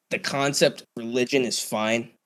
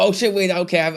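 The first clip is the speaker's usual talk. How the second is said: usual speaking voice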